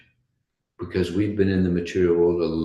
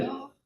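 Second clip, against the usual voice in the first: high, soft whine; sad dog noise; 10 dB quieter than usual. Acted. Dog as you're leaving, sad little whine. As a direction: whimper like a dog left behind.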